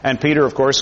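ordinary delivery

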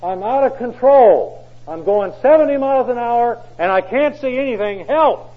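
high, very loud frantic cry; panicked plea. Retold or imitated. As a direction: beg for help in panic. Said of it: "And he was panicked".

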